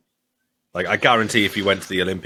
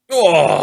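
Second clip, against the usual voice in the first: loud, high "oh!" of shock; pained gasp; 9 semitones higher, 8 dB louder than usual. Real, not acted. Watching a painful move land, loud high gasp. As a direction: cry out as if feeling the hit.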